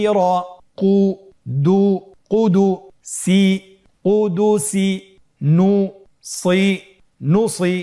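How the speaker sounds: deliberate teacher's chant of syllables into words; syllable reading demo. Shown as a demonstration. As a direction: sound out each syllable, then join them for learners. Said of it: "বানান ছাড়াই পড়ুন".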